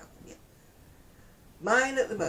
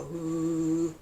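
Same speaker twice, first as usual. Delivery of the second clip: loud, long-held "ooh"; wobbly knees noise; 6 dB louder than usual. Retold or imitated. Acting out knees giving way on ladder, mock alarm. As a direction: hold out a teetering "ooh". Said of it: "me knees go".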